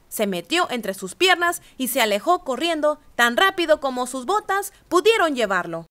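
usual speaking voice

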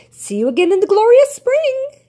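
high, sing-song bird goodbye; singsong farewell. A narrator's reading. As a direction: lilt it like a little bird song. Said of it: "the bird sang".

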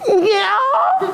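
high mock meow, laughing through it; a hungry cat crying at the window, high; high cat meow, half laughing; imitating a cat. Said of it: "And he's going".

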